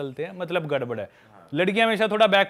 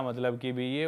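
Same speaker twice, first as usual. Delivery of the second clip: usual speaking voice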